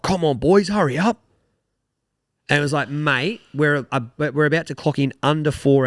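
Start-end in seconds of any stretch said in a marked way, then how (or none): 0.0-1.2 higher, louder mock nagging; old fella shouting at the boys ahead; impatient old fellas. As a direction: hurry them along like a grumpy old golfer. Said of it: "they were like".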